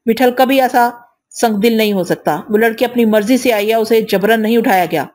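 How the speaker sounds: ordinary delivery, nothing marked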